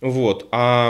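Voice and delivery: ordinary talk, nothing marked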